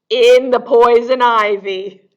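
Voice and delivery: loud comic reveal; punchline reveal. A narrator's reading. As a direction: land the joke big and loud. Funny punchline of the story.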